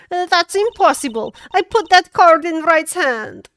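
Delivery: put-on high, loud protest in a Russian accent. Witness protesting about the planted card, loud Russian accent. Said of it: "learn your Russian accent from watching Dota".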